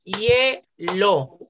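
Hielo split hie-lo with a gap; two syllables. Shown as a demonstration. Teacher shows class the count, Hielo broken into hie-lo. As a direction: say Hielo in two separate beats, hie kept whole. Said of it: "Solo tiene dos sílabas".

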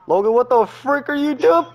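high, loud what-the-frick; exasperated yell. Real, not acted. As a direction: yell it in disbelief. Friend yelling at player.

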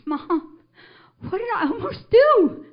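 high, loud anguished wail; horrified son. Retold or imitated. Son to the mother he nearly ran over, horrified wail. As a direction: imitate a son crying out in horror.

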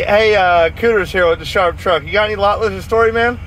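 ordinary talk, nothing marked

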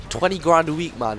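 indignant repeat. Real, not acted. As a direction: spit out Twenty grand a week, falling, outraged.

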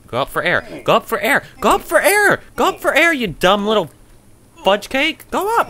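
frantic, very high, loud yelling; panicked shouting. Real, not acted. Player yells at character running out of air, panicked shout. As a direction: shriek the command over and over, rising in pitch.

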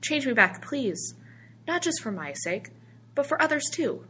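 high, earnest begging; pleading. A narrator's reading. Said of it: "George pleaded".

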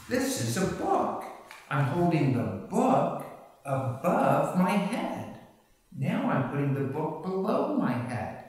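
put-on high-pitched, sing-songy talk to a baby; pitchy, happy baby-directed voice with stretched vowels; parentese demo. Shown as a demonstration. Parent showing baby a book, high sing-song parentese. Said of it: "I'll give an example".

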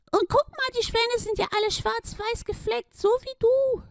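very high duck voice calling out. Acted. Duck shows Schlumi the spotted swans, high call. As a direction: cry out in the duck's high voice. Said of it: "rief die Ente".

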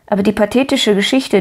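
usual speaking voice

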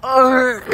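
high, loud "arr" noise; short burst of arr; demonstrated growl. Shown as a demonstration. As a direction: let out a mock growling arr. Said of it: "you just go like".

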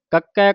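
ਕੱਕੈ with a firm doubled k; tightly pressed consonant. Shown as a demonstration. Teacher demonstrates for congregation, ਕੱਕੈ with tight doubled k. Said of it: "ਕੱਸ ਕੇ ਬੋਲਣਾ ਹੈ".

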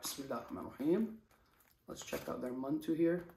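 ordinary talk

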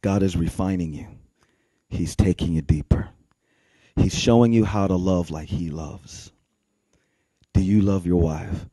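low, soft, measured encouragement; quietly counsel, pausing between phrases; re-enacted gentle mentor, deep and hushed; pastor's calm counsel. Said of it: "he says, Well, bro".

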